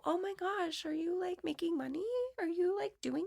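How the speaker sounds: high, soft mock concern; condescending cutesy tone. Tech people asking if she earns money, mock condescending. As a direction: imitate patronizing acquaintances in a high, small voice. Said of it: "they get this, like, oh my gosh, that's so cute, like, tone".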